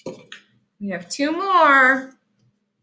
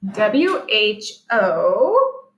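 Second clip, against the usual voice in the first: letters "W H O" spaced slow; slow letter-by-letter spelling; spelling out slowly; much slower than usual. Shown as a demonstration. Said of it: "That spells who".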